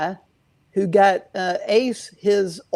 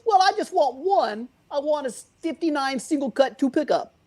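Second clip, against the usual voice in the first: retold caller in a thick New York accent, pitched higher; 7 semitones higher than usual. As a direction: mimic a heavy New York accent on the phone. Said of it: "in the thickest New York accent".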